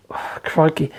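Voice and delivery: breathy exasperated whisper of Crikey. Real, not acted. Vlogger whispering his disbelief at the reader's letter. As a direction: let it out on a tired sigh.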